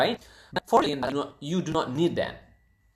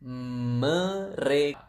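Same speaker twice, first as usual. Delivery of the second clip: very slow, syllable by syllable; slow model word; much slower than usual. Shown as a demonstration. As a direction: stretch each syllable of "Mereka" for the learner. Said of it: "How do you say they?".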